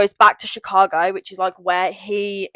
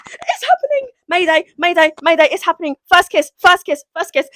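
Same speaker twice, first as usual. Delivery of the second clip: high, frantic fangirl alarm; excited mock alarm; 9 semitones higher, 4 dB louder than usual. Reader announcing the book's first kiss, excited mock alarm. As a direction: raise a giddy mayday, voice pitched right up.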